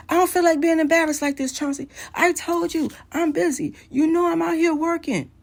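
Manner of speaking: high, loud mock tirade; imitating angry outburst. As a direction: act out an irate husband blowing up on the phone. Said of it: "He does the same thing again".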